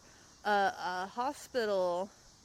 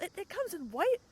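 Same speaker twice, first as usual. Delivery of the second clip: retold excited yell; 9 semitones higher than usual. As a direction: squeal it in delighted disbelief, rising at the end. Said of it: "and I yelled".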